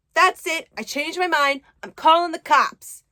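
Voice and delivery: retold angry threat to call the cops; furious outburst. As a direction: blow up at him and threaten. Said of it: "That pisses Allie off".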